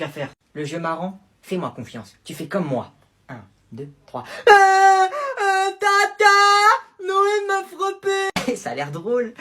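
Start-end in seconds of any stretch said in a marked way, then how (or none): 4.5-8.6 put-on wailing child voice, high pitched; whiny sobbing toddler; fake crying kid. Little kid crying to auntie.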